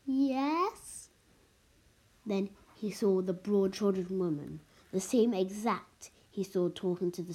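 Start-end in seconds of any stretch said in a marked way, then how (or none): 0.0-1.1 high, drawn-out worried question; worried, rising and stretched out. A narrator's reading. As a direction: answer warily, pitch sliding up high. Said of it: "He said in a worried voice".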